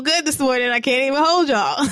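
high giggling; quirky high giggle; giggly. Real, not acted. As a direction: laugh through it. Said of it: "I'm feeling quirky".

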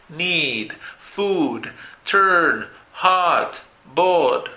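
"Need, food, turn, hot, board" each held long and falling; long single vowels. Shown as a demonstration. Teacher shows one mouth position in Need, food, turn, hot, board, long vowels. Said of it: "la posición de la boca solamente tiene una".

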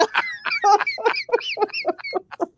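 squealing laugh; real burst of laughter; high laughter. Real, not acted. Laughing at own joke.

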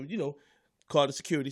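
ordinary talk

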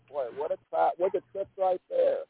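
usual speaking voice

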